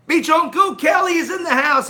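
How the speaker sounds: loud, high announcer-style call; hype shout. Real, not acted. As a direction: belt out the arrival like an MC. Announcing a viewer arrival.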